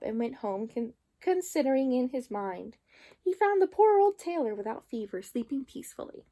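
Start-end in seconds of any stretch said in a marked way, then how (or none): none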